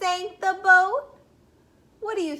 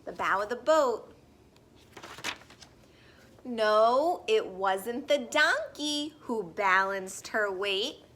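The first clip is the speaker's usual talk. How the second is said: slow, sing-song picture-book read-aloud; gentle storybook voice for little listeners; reading to children; 5 semitones lower, slower than usual. Acted. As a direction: read it like story time on the rug.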